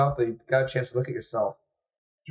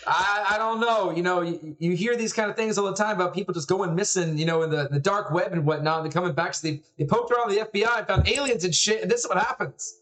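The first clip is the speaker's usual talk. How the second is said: rambling, higher-pitched suspicious father; worried dad; 7 semitones higher than usual. Acted. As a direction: fret aloud like a conspiracy-minded dad. Game master plays worried father.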